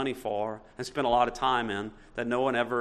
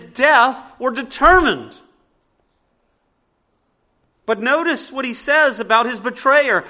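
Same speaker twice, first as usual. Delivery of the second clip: higher, louder preacher's build; full-force preaching; 9 semitones higher, 11 dB louder than usual. Acted. Pastor preaching the decree to the church, full-force preaching. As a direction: lift the room with conviction.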